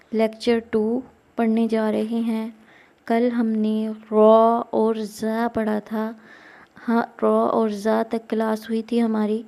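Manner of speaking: ordinary talk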